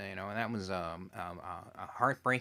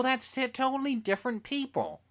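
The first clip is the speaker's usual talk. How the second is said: retold high protest; 11 semitones higher than usual. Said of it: "I go".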